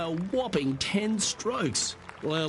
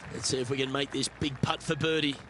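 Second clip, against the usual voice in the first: golf commentator; 4 semitones lower, 7 dB quieter than usual. Acted.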